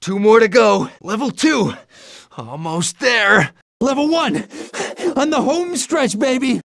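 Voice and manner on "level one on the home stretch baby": high, excited call; elated. Acted. Hero reaches level one on home stretch, high elation. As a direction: pitch it way up, nearly home free.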